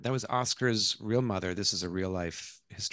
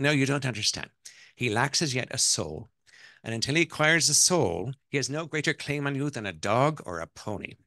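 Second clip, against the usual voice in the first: higher, emphatic Irish matriarch; Irish accent; 5 semitones higher, 4 dB louder than usual. Acted. Mother-in-law lectures the wife on children, Irish accent. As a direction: cut in firmly with an Irish accent. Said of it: "that's my Irish accent".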